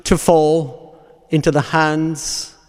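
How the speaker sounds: measured, fervent sermon close; solemn preaching. Real, not acted. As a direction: preach it weightily, phrase by phrase, letting each one fall. Preaches a closing meditation on Christ's death.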